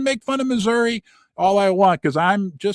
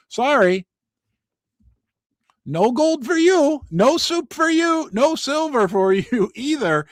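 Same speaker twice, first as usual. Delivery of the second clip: mock refusal; 8 semitones higher, 3 dB louder than usual. Mock dealer refusal, Seinfeld parody.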